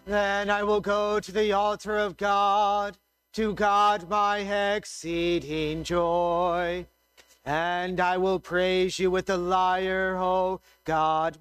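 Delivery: ordinary delivery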